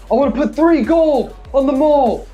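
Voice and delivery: high, loud shouted bet; shouting bettor. Acted. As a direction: yell your bet over the crowd. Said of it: "And they're like".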